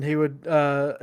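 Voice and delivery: ordinary delivery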